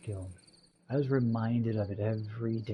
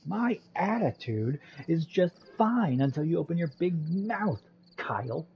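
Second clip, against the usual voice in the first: higher, heated comeback; angry retort; 4 semitones higher, 3 dB louder than usual. Acted. Jess quarrelling with Kyle over attitude, angry. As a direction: hit back hotly in a bickering match. Hits back in acted quarrel.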